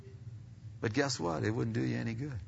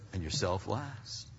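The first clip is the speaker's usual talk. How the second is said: usual speaking voice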